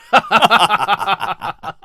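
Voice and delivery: put-on long, rolling old-friends' laugh; hearty laugh. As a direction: laugh on and on in satisfaction. Two old friends agree, delighted. (开怀大笑)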